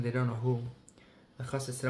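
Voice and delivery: ordinary delivery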